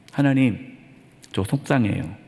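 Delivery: low, softened admission of hurt; acted upset confession. Preacher models a believer confessing to God, mildly upset. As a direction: lightly act hurt, dropping low and quiet. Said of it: "이해가 되지 않아서 속상할 때에".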